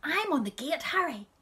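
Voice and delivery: high, loud showing-off shout; shouting to a friend. A narrator's reading. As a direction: yell it across the road to Bad Harry. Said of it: "My little sister shouted".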